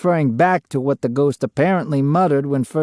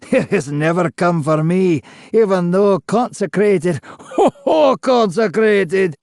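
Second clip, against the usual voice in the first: high, loud laughing scorn; mocking laughter; 6 semitones higher, 3 dB louder than usual. A narrator's reading. Ghost mocking consecration with laughter. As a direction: laugh through it. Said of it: "followed by a long moment of laughter".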